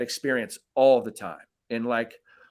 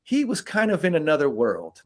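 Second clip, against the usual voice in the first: usual speaking voice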